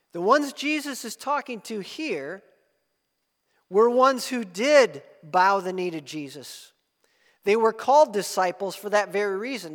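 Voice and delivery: forceful pulpit delivery; preaching. Acted. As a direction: press the point home to the congregation.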